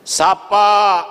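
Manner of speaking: loud, high command to greet; emphatic call. Acted. Preacher urges the congregation to greet others, loud. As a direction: urge them loudly.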